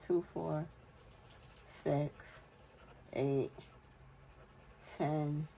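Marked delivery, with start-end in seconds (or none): none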